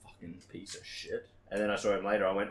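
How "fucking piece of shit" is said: whispered muttered insult; under-breath curse. Retold or imitated. After the manager walks away, whispered curse. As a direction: hiss it under your breath.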